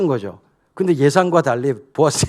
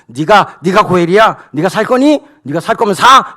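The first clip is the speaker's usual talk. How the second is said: louder, higher jabbing questions; badgering; 4 semitones higher, 7 dB louder than usual. Boaz badgering the redeemer, loud and jabbing. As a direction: keep jabbing the same word. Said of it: "뭐 이러면서 계속 몰아붙인 거예요".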